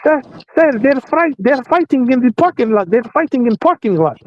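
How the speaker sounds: high, urgent woman's voice; imitating a frantic lady calling out; panicked lady. The lady ran up to him, high frantic call.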